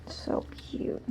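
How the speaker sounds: breathy whispered so cute. Real, not acted. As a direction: whisper it softly. Whispered aside.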